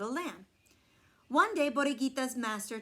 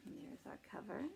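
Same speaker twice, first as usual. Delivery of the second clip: whisper; 13 dB quieter, faster than usual. Real, not acted. Quick aside while showing book.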